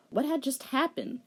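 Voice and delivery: ordinary talk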